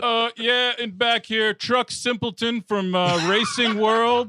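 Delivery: drawn-out goofy press question; put-on reporter. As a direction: introduce yourself as a dim journalist. Plays reporter at press conference.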